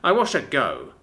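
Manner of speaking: ordinary delivery, nothing marked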